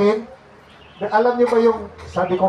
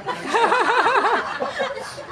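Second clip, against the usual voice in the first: high laugh; 3 dB quieter than usual. Real, not acted. Laughing at dead microphone.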